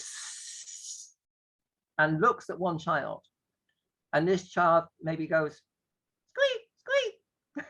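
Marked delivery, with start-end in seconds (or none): 0.0-1.1 long, soft sustained hiss; snake hiss. Shown as a demonstration. Snake teacher looks at one child, long hiss. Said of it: "the teacher goes". 6.3-7.7 tiny, very high-pitched mouse voice; mouse squeak. Acted. Child playing a mouse answers teacher, high squeaks. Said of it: "they've decided to be a mouse".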